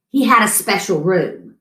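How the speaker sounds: plain reading voice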